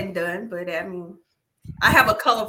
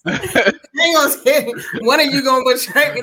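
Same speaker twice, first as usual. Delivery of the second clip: mock Jesus at Last Supper; 5 semitones higher, 9 dB louder than usual. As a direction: proclaim the betrayal with a laugh. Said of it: "whoever I gave this bread to".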